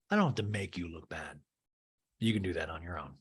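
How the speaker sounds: low, quiet, cool put-down; understated low comeback; mock Garcelle. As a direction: deliver the burn coolly and softly. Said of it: "Garcelle looks at her and goes".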